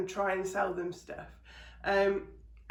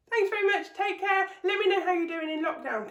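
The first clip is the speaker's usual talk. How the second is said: cheery sign-off; 10 semitones higher, 5 dB louder than usual. Real, not acted.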